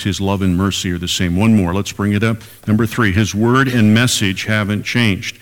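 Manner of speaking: ordinary delivery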